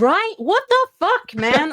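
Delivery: high, loud, incredulous outburst; exasperated disbelief. Real, not acted. Guest agreeing the missing cows are suspicious, incredulous. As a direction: burst out in baffled agreement.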